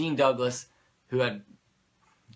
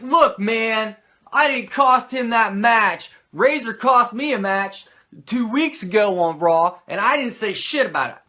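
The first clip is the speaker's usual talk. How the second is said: louder, higher indignant protest; Kid protesting; 10 semitones higher, 10 dB louder than usual. Retold or imitated. The Kid protests to McMahon, loud and indignant. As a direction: defend yourself, fed up. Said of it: "the Kid was like".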